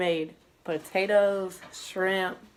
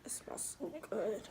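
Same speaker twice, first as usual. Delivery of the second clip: whispering; 3 semitones higher, 12 dB quieter than usual. Real, not acted. Whispered food comment.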